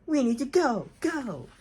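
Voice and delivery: high-pitched storybook yell; loud yell. A narrator's reading. Gerald urging the family to go, mock yelling. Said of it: "Gerald yelled so loudly".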